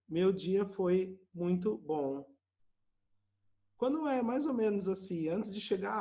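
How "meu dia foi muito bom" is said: slow, every word apart; robotic textbook reply; stiff slow answer. Retold or imitated. Guest gives a slow, stiff textbook answer at dinner. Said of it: "fala assim".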